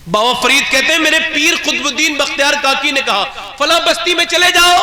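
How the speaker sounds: high, loud impassioned preacher; sermon at full throttle; fiery preaching. Acted. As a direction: raise the voice to stir the crowd.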